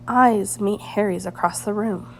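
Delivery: ordinary delivery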